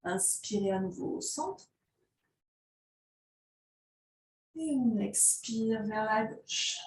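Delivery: soft, slow, drawn-out yoga instructions; yoga breathing cues. Real, not acted. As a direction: stretch each cue to the length of a breath, softly. Leads slow breath-paced stretches.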